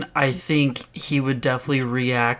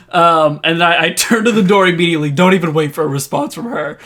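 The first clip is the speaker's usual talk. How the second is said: ordinary delivery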